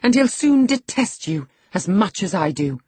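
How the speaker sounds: high, loud scorn; defiant taunt. A narrator's reading. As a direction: fling it at him, pitched high. She taunts the master.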